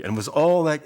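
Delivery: ordinary talk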